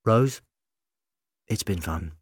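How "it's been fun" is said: sad farewell. A narrator's reading. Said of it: "real sadness in his eyes".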